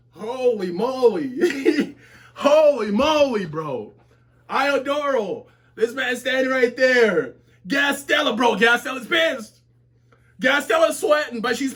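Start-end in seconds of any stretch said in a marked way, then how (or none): none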